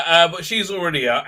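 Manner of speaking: normal talking voice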